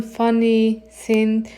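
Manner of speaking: ordinary talk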